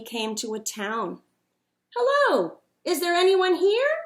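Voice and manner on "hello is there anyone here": high, loud call into the dark streets. A narrator's reading. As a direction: call out for anyone awake. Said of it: "he called".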